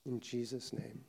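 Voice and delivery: soft, low prayer voice; closing prayer. Acted. Pastor leading the congregation in closing prayer, soft and low. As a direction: pray it quietly and humbly.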